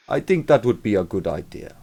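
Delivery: a clumsy fake South African accent. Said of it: "worst fake South African accent".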